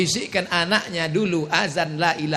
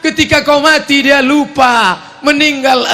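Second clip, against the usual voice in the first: preaching shout; 10 semitones higher, 9 dB louder than usual. Acted. Scolding spoiling parents.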